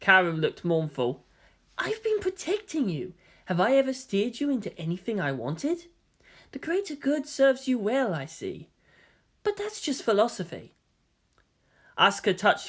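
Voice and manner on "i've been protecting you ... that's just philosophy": put-on soft, higher boy voice; gentle young man. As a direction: defend yourself gently. Voices Kaoru defending himself.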